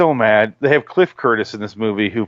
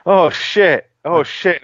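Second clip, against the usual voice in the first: higher-pitched dawning dread; replaying an oh-no moment; retold alarm; 8 semitones higher, much slower than usual. Moviegoer reliving the moment he saw the rating, alarmed.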